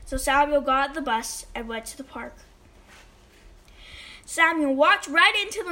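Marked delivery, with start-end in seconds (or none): none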